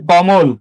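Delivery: কমল with the middle vowel rounded to o; o-sound pronunciation. Shown as a demonstration. Teacher applies the middle-letter rule, pronunciation demo. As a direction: say কমল as ko-mol. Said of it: "মধ্যবর্ণের অ উচ্চারণে ও হবে".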